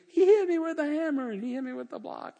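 high-pitched mock toddler wail; crying baby. Nursery child tattling about being hit, mock crying. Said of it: "they're crying and go".

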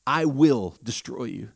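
low, soft, menacing devil voice; quiet threatening whisper of the devil; devil's whisper. Retold or imitated. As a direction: hiss it low like the tempter. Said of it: "He whispered this".

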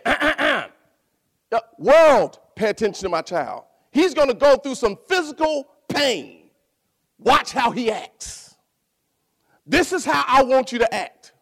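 a hint of a commanding God, proclaiming; lightly voiced God, calling the world to watch.

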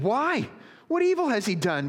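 high, loud, insistent questioning. A narrator's reading. Pilate challenging the crowd, high, loud protest. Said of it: "And he said".

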